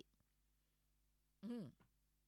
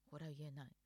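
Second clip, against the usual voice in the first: normal talking voice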